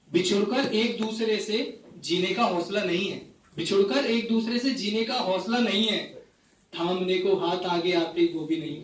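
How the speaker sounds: phrase-by-phrase mushaira delivery; poetry recitation. Acted. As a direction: recite each couplet to the audience with pauses. Recites a poem to audience. (मुशायरे के अंदाज़ में कविता पाठ)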